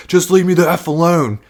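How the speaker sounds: high, loud mock snap; exasperated outburst. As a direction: blow up at a nagging partner, playfully acted. Acted fed-up outburst at partner.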